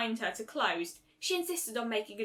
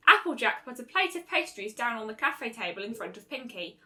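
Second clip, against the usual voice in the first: plain reading voice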